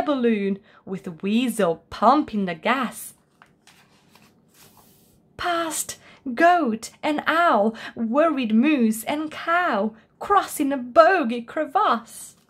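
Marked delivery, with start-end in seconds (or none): none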